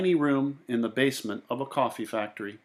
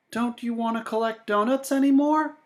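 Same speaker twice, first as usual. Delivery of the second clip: put-on higher, boyish storybook voice; Sam's voice; 10 semitones higher than usual. A narrator's reading. A boy asking his friend, higher voice. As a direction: voice young Sam asking, lightly higher. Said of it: "said Sam".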